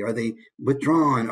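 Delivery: ordinary talk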